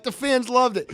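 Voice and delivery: high-pitched, insistent retold protest; indignant protest. Suspended driver keeps arguing with the official, indignant.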